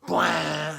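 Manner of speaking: loud mock organ blast "bwah"; organ blast noise. Showing the organ with all stops out, a loud bwah.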